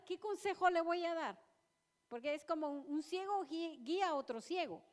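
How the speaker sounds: impassioned church exhortation; preaching at full force. Real, not acted. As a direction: drive each line home to the congregation.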